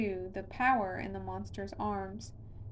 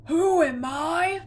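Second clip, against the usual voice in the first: much higher, louder and slow, roared out; booming monster roar; 8 semitones higher, 12 dB louder, slower than usual. A narrator's reading. Monster towers over Connor and booms the question. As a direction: let the monster's voice thunder. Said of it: "its voice getting louder".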